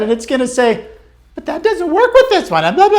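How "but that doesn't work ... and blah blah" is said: much higher put-on voice of self-doubt; nagging inner voice. Coach voicing the student's inner critic, high and whiny. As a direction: whine it like the doubting voice in your head. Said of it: "you're gonna hear the voice inside of your head".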